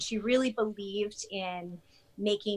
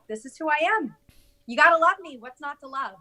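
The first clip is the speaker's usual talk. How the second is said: cocky; 5 semitones higher, 8 dB louder than usual. Retold or imitated. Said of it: "he's just like".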